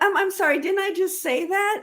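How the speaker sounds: high-pitched, incredulous objection; mock indignation. Overlooked woman in business objecting, mock indignation. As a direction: protest in put-on polite disbelief. Acts out her reaction to being overlooked.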